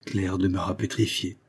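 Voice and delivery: usual speaking voice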